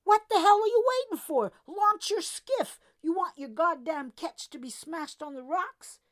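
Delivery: very loud, high furious yelling; shouting. A narrator's reading. Jordan yelling at the deckhand to launch his skiff, shouting. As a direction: yell across the dock in a storm.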